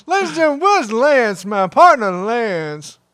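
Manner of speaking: loud, high, stretched-out announcer delivery; wrestling announcer impression. Announcer begging his departed partner to return, loud and hammed-up. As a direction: plead like an over-the-top TV wrestling host.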